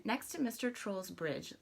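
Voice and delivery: usual speaking voice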